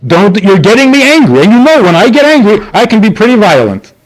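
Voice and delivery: high-pitched, heated mock warning; threatening parent. Parent warning a kid about their own temper, heated mock threat. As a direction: imitate a worked-up parent threatening. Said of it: "what we're saying to the kid".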